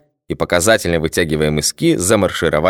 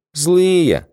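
timid soldier; 11 semitones higher than usual. A narrator's reading.

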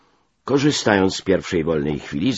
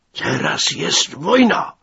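high, slow, strained shout; enraged roar; 13 semitones higher, 5 dB louder, slower than usual. A narrator's reading. Major pounds the table at a subordinate, enraged. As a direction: roar it slowly in a rage.

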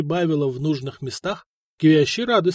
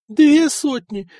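higher, louder, slow outcry on две; outraged exclaim; 11 semitones higher, 7 dB louder, slower than usual. A narrator's reading. Wife exclaims over two hundred solidi given away. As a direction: cry out the sum in disbelief.

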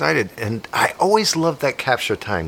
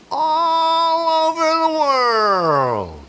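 high, slow, drawn-out announcer sell; grand pitch; much slower than usual. Acted. Host pitches his haunted streams, high and slow. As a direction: stretch it out like a big promo.

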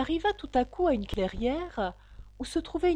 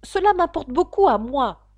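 briskly; 5 semitones higher, 8 dB louder than usual. Acted. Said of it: "dit Alice vivement".

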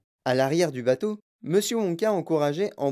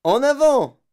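high, very loud yell; yelled order; 10 semitones higher, 9 dB louder, slower than usual. A narrator's reading. Wonka cutting off questions to urge rowers, yelling. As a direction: bellow it to the rowers. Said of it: "hurla Monsieur Wonka".

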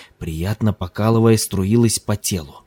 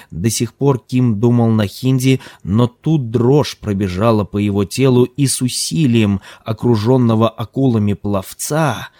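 ordinary delivery, nothing marked

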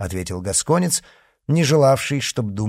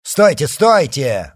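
loud, slow, raised cry; shouted order; 6 semitones higher, 8 dB louder, slower than usual. A narrator's reading. Monseigneur halts his charging riders, shouting. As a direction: call a halt, drawing it out. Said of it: "закричал тот".

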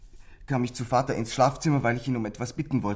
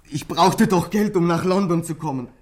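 desperate outburst; 7 semitones higher, 8 dB louder than usual. Acted. Boy pleading with the inspector, loud and desperate. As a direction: burst out in self-defence.

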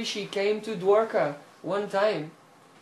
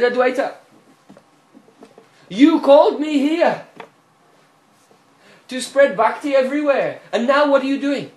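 upset reproach; 8 semitones higher, 12 dB louder than usual. A narrator's reading. Mahaprabhu upsetly scolding Advaita on the doorstep. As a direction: rebuke him for betraying the mission. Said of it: "He was very upset".

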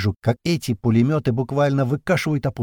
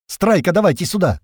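high, loud call; shouting across room; 8 semitones higher, 5 dB louder than usual. A narrator's reading. Narrator shouts for Strike across the room, high loud. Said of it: "кричу я на всю комнату".

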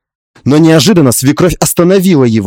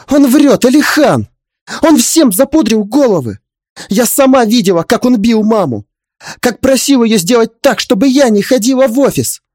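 high-pitched urgent outburst; flustered protest; 9 semitones higher, 3 dB louder than usual. Acted. Wife telling husband his father lies, flustered and urgent. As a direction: insist desperately in a raised voice. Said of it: "Начала сбивчиво я".

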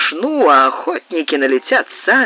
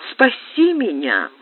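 slow, higher plea from a dream; sleep-talking; 6 semitones higher than usual. A narrator's reading. Clara in a nightmare begging to be saved, sleep-talking. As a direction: plead drowsily for rescue. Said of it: "говорила во сне Клара".

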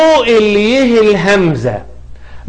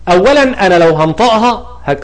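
ordinary talk, nothing marked

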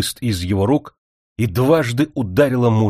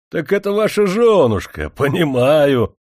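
laughing soldier; 6 semitones higher, 4 dB louder than usual. A narrator's reading. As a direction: laugh the words out, amused. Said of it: "Снова засмеялся он".

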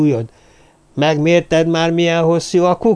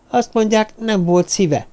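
usual speaking voice

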